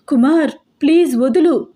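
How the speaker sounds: high, loud plea to let go; desperate shout. A narrator's reading. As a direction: cry out to be released. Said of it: "అరుస్తున్నాను నేను". (గట్టిగా అరుస్తూ వేడుకోలు)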